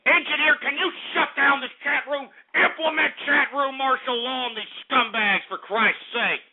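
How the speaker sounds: high, indignant outburst at troublemakers; exasperated. Real, not acted. Radio host ordering the engineer to shut chat down, exasperated. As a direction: raise the pitch and demand action, fed up.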